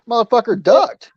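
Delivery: normal talking voice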